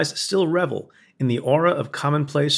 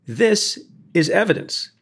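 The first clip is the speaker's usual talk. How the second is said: slow, high "This", then a break; deliberate courtroom punch on "This"; emphatic stress on THIS; 6 semitones higher, slower than usual. Retold or imitated. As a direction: draw out "This" to present proof. Retold courtroom display to the jury.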